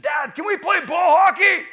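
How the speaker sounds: put-on high, loud kid voice; excited child's shout, put on; eager son. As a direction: imitate a boy begging to play. Voicing his eager young son.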